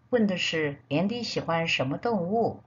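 ordinary delivery

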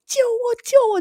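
high, loud calls of save me; retold cry for help. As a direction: yell for help as if pinned down. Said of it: "喉咙都要叫破了耶". (大声呼救)

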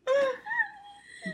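high-pitched burst of laughter; high giggle. Real, not acted. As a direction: squeal with laughter. Laughing at the story.

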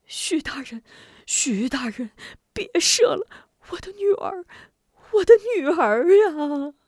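desperate grief-stricken plea; anguished pleading. A narrator's reading. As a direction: beg on your knees, breaking into a wail. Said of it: "张雄扑通给许仁跪下道".

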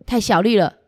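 usual speaking voice

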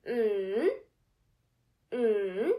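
"Mm" in a long swoosh, down then up; big third tone. Shown as a demonstration. Teacher drawing a big Nike with sound, long dipping hum. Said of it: "Let us nod the head again".

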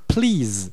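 PLEASE with a long, drawn-out ee; long vowel. Shown as a demonstration. Teacher showing a learner the long sound, drawn-out "please". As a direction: stretch the vowel of "please", tongue up. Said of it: "发长音".

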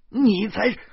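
loud, high snap back; hurt cop shouting back; angry retort. A narrator's reading. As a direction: fire it right back at him.

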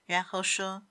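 usual speaking voice

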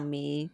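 咪 said as Taiwanese "mî", low and soft; Yilan pronunciation. Shown as a demonstration. Telling co-host how Yilan says porridge, 咪 as "mî". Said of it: "听说宜兰是讲".

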